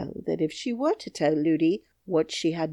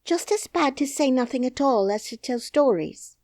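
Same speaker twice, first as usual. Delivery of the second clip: exclaiming; 8 semitones higher, 4 dB louder than usual. Acted. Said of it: "exclaimed the princess".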